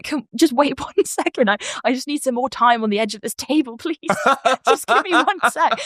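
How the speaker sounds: hammed-up plea to dream characters; pleading call. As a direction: beg them to wait, calling after them. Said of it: "I'm, like, calling after them".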